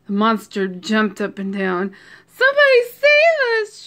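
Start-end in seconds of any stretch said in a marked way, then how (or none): 2.3-3.9 very high, loud cry for help; storybook shriek. A narrator's reading. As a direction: shriek for rescue, hammed up. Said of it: "shrieked the dogs".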